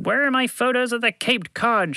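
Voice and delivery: high, angry editor's shout; shouting boss. A narrator's reading. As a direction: bellow it at the office. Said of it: "shouted G".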